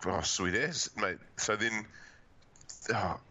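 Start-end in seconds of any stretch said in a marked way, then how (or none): none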